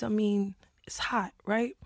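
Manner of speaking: hushed unvoiced aside; whispering. Real, not acted. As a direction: drop to a whisper. Whispered aside in scene setting.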